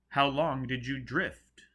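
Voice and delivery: high, loud and slow query; booming question. Acted. Joseph loudly questions the half-dead Foyle. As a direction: ask it grandly, like a showman.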